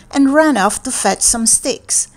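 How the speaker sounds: ordinary delivery, nothing marked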